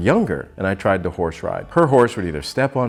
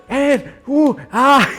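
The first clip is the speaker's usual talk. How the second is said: high, loud jolted ooh and ah; bouncing yelps; 7 dB louder, much slower than usual. Retold or imitated. Rider yelping while bouncing in saddle. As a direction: yelp with every bounce.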